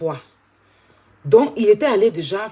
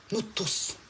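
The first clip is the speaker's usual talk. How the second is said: much softer, falling "Nous tous"; quiet echo; 11 dB quieter than usual. Real, not acted. Streamer repeating to her viewers, "Nous tous" hushed and falling.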